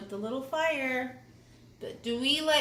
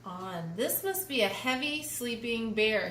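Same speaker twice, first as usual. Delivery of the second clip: plain reading voice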